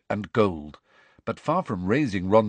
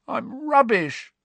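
much higher, slow miserable croak; Ron croaking; slower than usual. A narrator's reading. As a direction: drag out the self-pity. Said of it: "croaked Ron".

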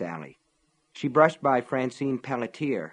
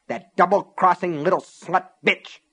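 loud, higher, venomous insult; spat in anger; 7 semitones higher, 5 dB louder than usual. A narrator's reading. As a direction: spit it out in fury. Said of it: "Cora spat".

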